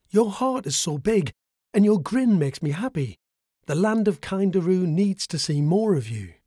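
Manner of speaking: high, bright frog voice; lightly voiced small frog; little frog voice. A narrator's reading. Frog voiced, praising Boo.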